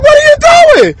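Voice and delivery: high, cracking yell of disbelief; imitating a stunned what-the-hell cry; shocked outburst. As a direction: shriek it like you can't believe it.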